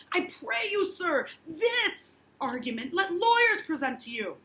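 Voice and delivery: high, loud anguished confession; anguished minister. Acted. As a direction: pour out the guilt. Hale confesses his shaking hand.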